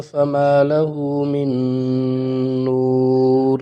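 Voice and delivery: slow melodic tajweed chant; Quran recitation. Shown as a demonstration. Teacher finishes reciting the verse, slow chant. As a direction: recite the whole verse in long tones.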